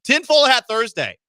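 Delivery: loud, high segment announcement; hyped-up show segment intro; announcer call. Acted. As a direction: boom it out like a show segment title. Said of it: "segment announcer call".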